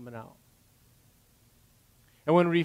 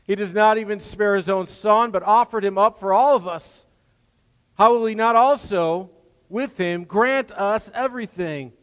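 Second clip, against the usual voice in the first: loud, high preaching, slowed on key lines; fervent scripture reading; 4 semitones higher, 8 dB louder than usual. Acted. Preacher reading Romans aloud to the church, fervent and loud. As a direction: proclaim each question to the whole congregation.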